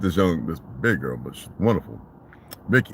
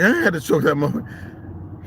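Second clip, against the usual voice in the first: excited; 6 semitones higher than usual. Retold or imitated. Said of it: "So I was like".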